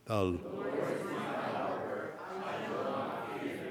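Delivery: soft, slow distant group murmur; a weak crowd response from far off; half-hearted congregation reply; faint congregation murmur. Real, not acted. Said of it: "say it with some conviction".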